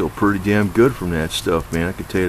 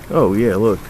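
normal talking voice

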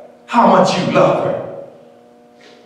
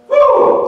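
high woo. Real, not acted. Preacher's exclamation.

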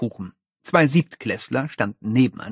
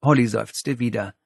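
ordinary delivery

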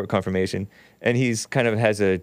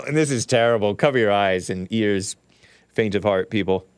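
ordinary talk

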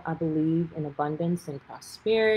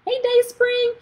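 high, bright greeting; chirpy hello to the publisher online; cheerful hello; 6 dB louder, slower than usual. Real, not acted. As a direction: call out happily. Greeting publisher joining live.

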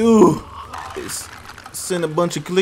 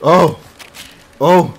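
startled cry; 3 semitones higher, 7 dB louder than usual. Real, not acted. Startled cries in game.

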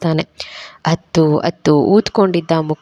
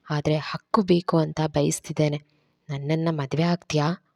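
softer, tender proposal; soft and gentle; 8 dB quieter than usual. A narrator's reading. Randhir softly asking Saveri to marry him, tender. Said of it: "ಮೃದುವಾಗಿ ಕೇಳ್ತಾನೆ".

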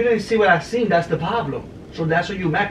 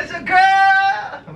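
excited; 9 semitones higher, much slower than usual. Real, not acted. Excited guess about their baby.

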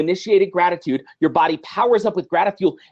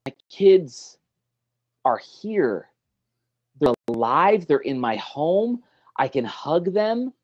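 tender gratitude; slower than usual. Shown as a demonstration. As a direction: savor each word gently. Grateful reflection on kids.

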